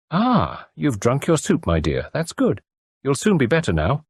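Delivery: cosy country landlady; kindly farm wife. Acted. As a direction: fuss over the patient kindly. Landlady character voice.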